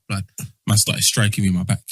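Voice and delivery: ordinary talk, nothing marked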